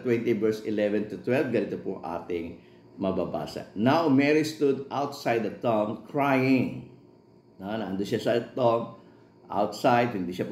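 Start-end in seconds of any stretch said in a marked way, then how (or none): 3.8-6.9 slow, measured Bible reading; scripture reading. Acted. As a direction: read the verse slowly and solemnly. Reads scripture aloud in English.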